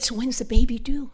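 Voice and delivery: whispered, confiding question. Retold or imitated. Asking the woman about her baby in a hushed whisper. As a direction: lean in and ask it secretly. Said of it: "And I said".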